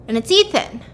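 ordinary talk, nothing marked

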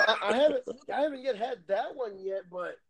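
ordinary talk, nothing marked

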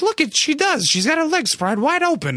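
high-pitched imitation of an old East Coast lady. Old lady nudging a seat neighbor, high imitated voice. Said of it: "cute little East Coast accents".

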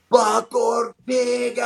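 long, drawn-out shout, pitched high; excited shout. Retold or imitated. The player name drawn from the card, shouted out excitedly. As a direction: call the name out like a stadium announcer.

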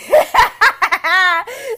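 high, loud cackle; loud laughing burst. Real, not acted. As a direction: crack up out loud.

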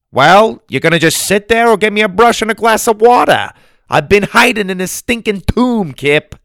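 high, loud, quick demand; feisty impatient grandma. A narrator's reading.

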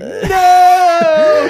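huge screaming no as he falls; anguished scream. Acted. Man falling into hell screams no. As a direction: scream it like falling to your doom.

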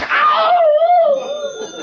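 sharp yelp of pain; sudden pained yelp. Acted. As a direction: cry out as if just bitten. Bitten on the hand mid-sentence.

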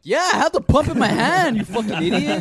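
high, loud, furious retort; high-pitched angry shout at the gas station worker; exasperated yell. Retold or imitated.

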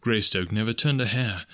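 usual speaking voice

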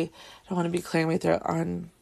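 ordinary talk

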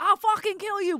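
high, loud threatening yell; furious scream. Retold or imitated. Friend punching the police car, screaming a threat. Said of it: "Scream, right?".

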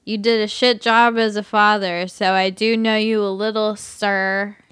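put-on sloppy, slurring drunk man. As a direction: whine like a drunk guy. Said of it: "I'll just do my drunk voice".

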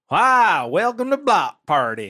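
vintage cartoon voice. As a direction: play a goofy cartoon yokel welcoming listeners. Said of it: "you did the laugh, too".

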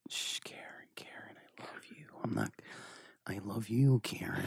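unvoiced whisper, soothing a woman; whispered Ray Liotta. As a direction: hush her while whispering how you love her. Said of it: "I love Ray from Goodfellas".

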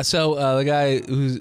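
normal talking voice

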